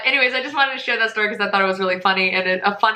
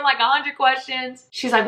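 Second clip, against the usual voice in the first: ordinary delivery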